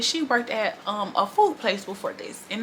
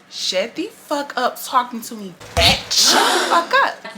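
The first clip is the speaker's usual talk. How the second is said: slow, loud furious snap; laughing shout at the end; angry outburst; 3 semitones higher, 5 dB louder, slower than usual. Retold or imitated. Snapping at coworker.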